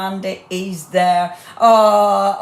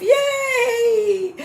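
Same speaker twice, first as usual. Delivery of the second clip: excited yay. Real, not acted. Host cheers viewers now live, high squeal. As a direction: cheer that the stream works. Celebrating the mic going live.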